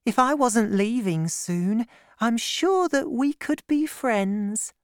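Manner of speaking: put-on high, gentle girlish voice; young woman. A narrator's reading. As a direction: play Constance, shy and kind. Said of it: "said the young woman".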